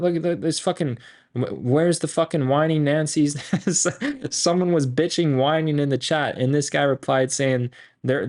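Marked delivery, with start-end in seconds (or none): 3.4-4.3 laugh. Real, not acted. Laughing at whiny chat comment.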